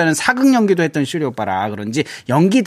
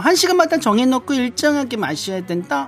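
high, sing-song coworker chatter; pleased, preening colleague; 11 semitones higher than usual. Acted. Flattered coworker giving water-drinking advice, pleased high chatter. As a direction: soak up praise and share beauty tips.

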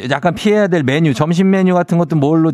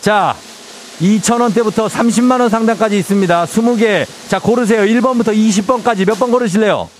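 booming prize announcer; 8 semitones higher than usual. Real, not acted. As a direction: hype up the prize draw like a host. Radio host announcing prize draw. (우렁찬 경품 발표 목소리)

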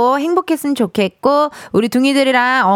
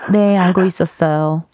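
rich lady; 7 semitones lower than usual. Retold or imitated. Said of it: "재벌집 그 사모님처럼".

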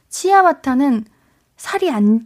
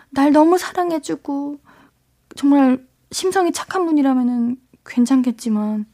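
ordinary talk

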